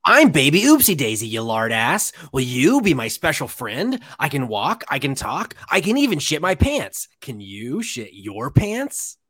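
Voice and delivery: sing-song evil toy taunt; creepy doll voice. Acted. Voicing killer doll toy.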